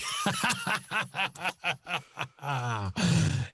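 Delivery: high staccato evil cackle; long theatrical villain laugh. Acted. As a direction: cackle like a triumphant baddie. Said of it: "as if he was a Bond villain".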